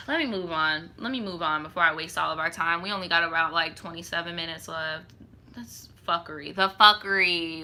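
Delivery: sigh, then low, soft and fed up; weary resignation. Real, not acted. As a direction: drop the voice, done with this. Fed up, sighs and moves on.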